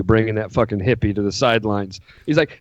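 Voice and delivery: ordinary delivery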